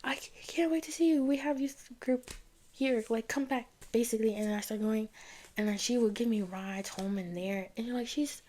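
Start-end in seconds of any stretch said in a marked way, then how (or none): 0.0-3.7 much higher, gushing friend voice; excited friend. Retold or imitated. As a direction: gush like an old friend who can't wait to see her. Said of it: "she's like".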